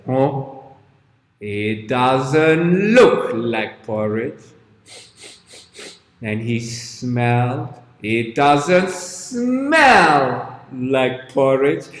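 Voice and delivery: low, slow, drawn-out giant voice; suspicious giant. Acted. Giant sniffing sandy bucket, slow low suspicion. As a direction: sniff it out slowly like a puzzled giant.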